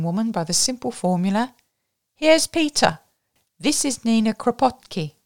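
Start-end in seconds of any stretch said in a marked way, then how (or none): 2.1-5.1 higher, louder introduction; raised-voice introduction. A narrator's reading. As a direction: call out an introduction over a noisy party. Introduction in a noisy room.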